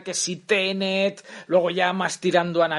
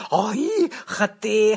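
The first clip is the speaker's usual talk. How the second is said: ordinary talk, nothing marked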